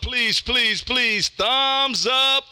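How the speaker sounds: ordinary delivery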